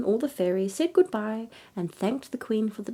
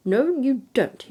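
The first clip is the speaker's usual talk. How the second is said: firm king; 5 semitones higher, 5 dB louder, slower than usual. A narrator's reading. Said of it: "said he".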